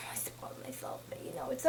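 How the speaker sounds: hushed, guilty whisper; whispered confession. Real, not acted. Confessing a spoiler.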